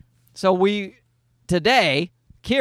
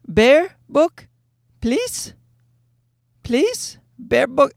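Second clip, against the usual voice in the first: put-on small high toddler voice, questioning; pleading toddler; 9 semitones higher, much slower than usual. Toddler son asks dad for the Bear book at bedtime, high pleading imitation. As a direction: imitate a little kid begging with rising pitch. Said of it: "he would say".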